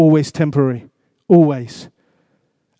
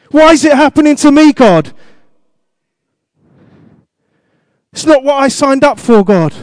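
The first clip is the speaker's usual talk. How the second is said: whining complaint to God; 8 semitones higher, 4 dB louder than usual. Pretend.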